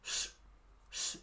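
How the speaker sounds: sh, sh as a soft hushing like wind. Shown as a demonstration. Teacher having the student copy a windy sh. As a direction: hush it out like a breeze. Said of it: "como el viento".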